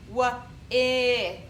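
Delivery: W-I sounded out as short i. Shown as a demonstration. Teacher sounding out winter, short I. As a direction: blend W-I with short i. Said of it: "It's saying I".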